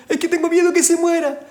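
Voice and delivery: high, whiny mock sob; mock crying plea. Preacher mocks a crying member asking for prayer. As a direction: imitate a tearful church member, high.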